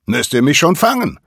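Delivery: high, loud mocking taunt; cheeky challenge yelled into the wind; taunting shout. A narrator's reading. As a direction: dare them to catch you. Nolan taunts the falcons.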